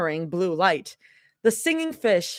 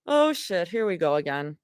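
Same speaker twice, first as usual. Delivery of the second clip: plain reading voice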